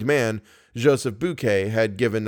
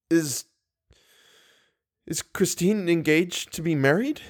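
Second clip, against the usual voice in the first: choking voice; 6 semitones higher than usual. A narrator's reading. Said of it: "asked in a choking voice".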